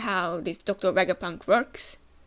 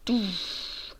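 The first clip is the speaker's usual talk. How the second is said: ordinary delivery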